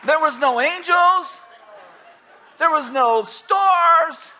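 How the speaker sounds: emphatic high preaching; slow, loud, pressing each line. Acted.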